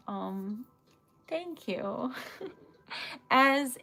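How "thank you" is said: usual speaking voice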